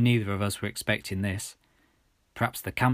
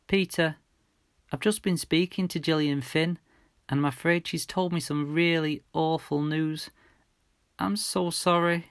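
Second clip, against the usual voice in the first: gentle, higher mum voice, bracing; breaking bad news; 7 semitones higher than usual. A narrator's reading.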